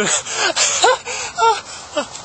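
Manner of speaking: high-pitched giggle. Real, not acted. Laughs at own joke.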